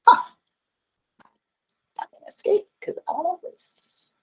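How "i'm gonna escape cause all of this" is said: hushed frustrated whisper to herself. Real, not acted. Teacher whispering her frustration at the laptop. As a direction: breathe it out under her breath.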